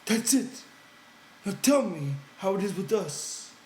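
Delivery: put-on light up with childlike joy; delighted. A narrator's reading. Lenny hearing his favorite story, high and delighted. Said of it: "Lenny was delighted".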